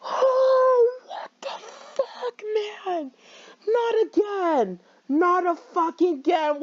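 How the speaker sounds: high, breaking, near tears; real shocked sobbing disbelief; crying out. Real, not acted. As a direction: wail it in real distress. Shocked by character's death.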